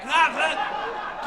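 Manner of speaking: loud mock coughs; imitated coughing. Grandfather coughing into grandson's mouth, loud mock cough. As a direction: hack right into someone's mouth. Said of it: "he's coughing into my mouth".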